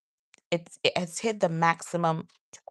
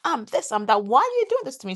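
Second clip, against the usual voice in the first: higher, protesting self-defence; acted defensiveness; 6 semitones higher, 4 dB louder, faster than usual. Wife reacting to a small correction, high defensive protest. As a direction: protest as if under attack, pitch jumping up. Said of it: "as a total attack on my character".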